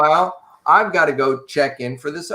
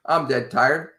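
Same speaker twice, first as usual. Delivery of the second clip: normal talking voice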